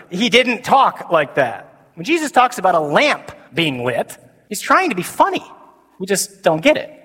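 ordinary talk, nothing marked

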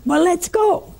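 high-pitched eager quote; retold excitement. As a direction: imitate an eager man raring to go. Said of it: "he was so excited".

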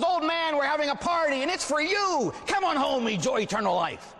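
very high, exuberant party invitation; jolly beermeister God. Acted. God as beermeister invites the old man in, jubilant. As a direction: whoop it up as a festive host. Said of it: "out comes God as his barbarian beermeister".

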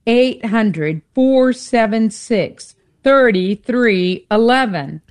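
slow, deliberate phone number; nice and slow. Real, not acted. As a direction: read it out slowly in spaced groups. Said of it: "say the number nice and slow".

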